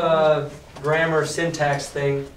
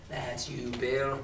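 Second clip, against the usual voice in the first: low sly pirate; 4 semitones lower, 11 dB quieter, slower than usual. A narrator's reading.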